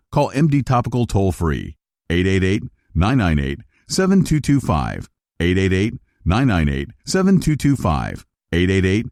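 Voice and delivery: usual speaking voice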